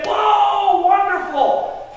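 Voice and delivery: high, drawn-out cheer of amazement; excited delight. Acted. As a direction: gush loudly with imagined joy. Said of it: "get all excited about you and say".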